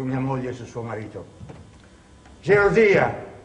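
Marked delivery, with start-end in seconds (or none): 2.4-3.3 high, loud accusing cry; loud, high, bitter exclamation; indignant exclamation. Acted. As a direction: throw the word at her like a charge. Exclaims the cause of it all.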